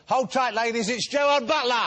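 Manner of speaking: big guest reveal; showbiz announcement. Acted. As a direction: whip the ladies into a frenzy.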